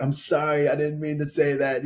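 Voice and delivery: pleading apology. Retold or imitated. Apologizing to an offended partner for what slipped out, pleading. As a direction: plead your way out of it. Retold apology while he was laughing.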